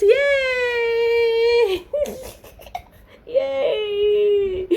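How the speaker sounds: high excited yay; excited cheer. Real, not acted. Cheering birthday gift.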